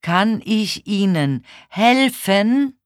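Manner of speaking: word by word, high and loud; very loud and slow. A narrator's reading. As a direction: ask slowly for someone hard of hearing. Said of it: "sagte ich sehr laut und sehr langsam".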